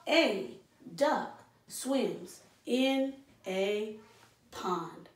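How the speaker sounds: "A duck swims in a pond" dragged very slowly, a pause between words. Shown as a demonstration. Teacher leads the class in repeating, very slow and spaced. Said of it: "So two fists up".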